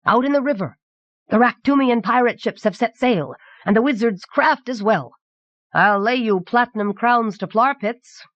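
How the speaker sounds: high-pitched, proclaiming announcement; emperor's bellow. A narrator's reading. Emperor spots the fleeing ships, calling out in alarm. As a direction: call it out to the room, pitch held high. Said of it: "bellowed the Emperor of Sobrania".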